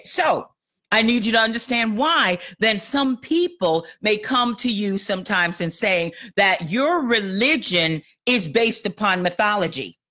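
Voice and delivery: normal talking voice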